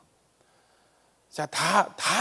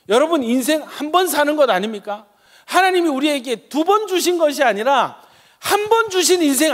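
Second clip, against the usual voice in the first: fervent sermon, rising to loud cries; impassioned pulpit preaching; 5 semitones higher, 6 dB louder than usual. Acted. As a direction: preach it at full force to the congregation.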